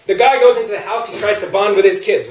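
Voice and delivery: ordinary talk, nothing marked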